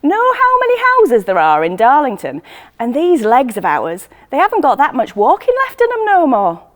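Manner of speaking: yelling up. Acted. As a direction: shout up, cheeky and fond. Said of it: "Betty yells up".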